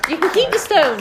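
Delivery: loud, high call across the room. Real, not acted. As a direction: call it out over the chatter. Calling out over departing guests.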